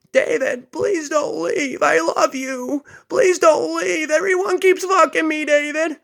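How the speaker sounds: mock distraught girl yelling high. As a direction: beg him not to leave, shouting high. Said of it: "I heard her yelling".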